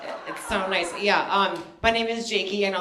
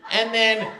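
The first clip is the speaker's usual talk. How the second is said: ordinary delivery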